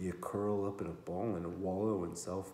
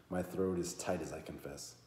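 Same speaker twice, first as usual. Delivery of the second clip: usual speaking voice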